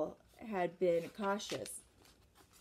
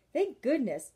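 a prim, distasteful sniff of relief; loud, higher, prim distaste; 5 semitones higher, 6 dB louder than usual. Acted. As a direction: say it as if holding your nose. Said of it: "said with distaste".

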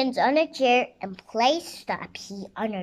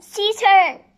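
high, loud shouted order; commanding call; 10 semitones higher, 12 dB louder than usual. A narrator's reading. Queen ordering guards onto the witch, commanding shout. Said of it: "called the queen".

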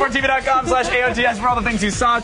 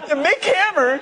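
laughing mock repeat, higher; 5 semitones higher, slower than usual. Real, not acted. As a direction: tease the name through laughter. Mocking the misread name.

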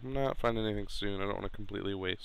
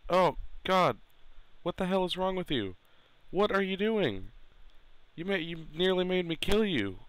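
high, exasperated outburst; startled scolding; 8 semitones higher than usual. Real, not acted. Player scolding the dog in its way, high exasperation. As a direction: jump in alarm, then tell the dog off in a raised pitch.

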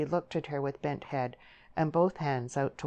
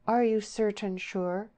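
slow, higher-pitched deliberate question; unhurried; 6 semitones higher than usual. A narrator's reading. Deborah making sure of her lover, unhurried. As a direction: take your time over each word. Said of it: "with her father's unhurried utterance".

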